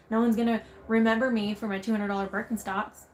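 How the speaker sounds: usual speaking voice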